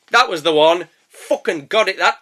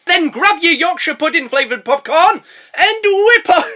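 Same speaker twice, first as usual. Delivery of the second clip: running short of breath; 10 semitones higher, 4 dB louder than usual. Real, not acted. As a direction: push through the last breath. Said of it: "run out of breath there".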